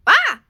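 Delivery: sharp high gasp of fright; father's loud startled gasp. Acted. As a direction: jump out of your skin with a gasp. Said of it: "gasped his father".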